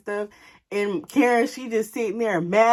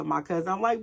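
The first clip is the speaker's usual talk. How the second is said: plain reading voice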